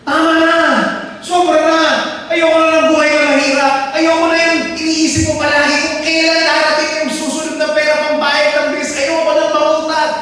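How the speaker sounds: loud, high, desperate outcry; shouting you're done with a hard life; fed-up outcry. Pretend. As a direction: cry out that you've had enough. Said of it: "Yung pag tinignan mo yung buhay mo".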